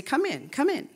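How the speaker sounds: high-pitched, coaxing welcome; inviting beckoning. Retold or imitated. Teacher gathering hallway students into class, inviting beckoning. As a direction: beckon them inside, lifting the pitch.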